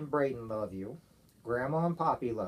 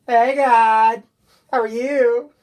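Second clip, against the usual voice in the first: cheerful loud greeting; 11 semitones higher, 20 dB louder, much slower than usual. Shown as a demonstration. Dad showing daughter how to greet God, loud and cheerful. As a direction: call out high and loud, like greeting a buddy. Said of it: "And what do we say?".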